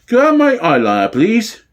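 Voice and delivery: a preening actor fussing over makeup; camp backstage primping. Acted. Superintendent asking constable for eyeliner, lightly camp and quick.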